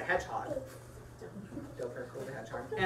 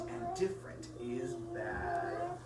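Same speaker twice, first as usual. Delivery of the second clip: menacing bug; 10 semitones higher, 9 dB quieter, slower than usual. Acted. Stink bug's final verdict.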